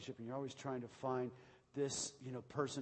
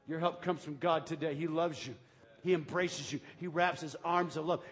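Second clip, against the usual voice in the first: ordinary talk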